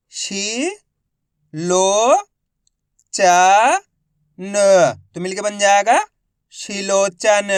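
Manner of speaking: slow, loud, stretched syllables; syllable reading drill. Shown as a demonstration. Teacher sounds out words for young pupils, slow. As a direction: sound out each piece for children.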